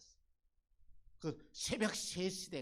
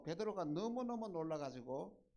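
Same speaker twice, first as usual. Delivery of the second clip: ordinary delivery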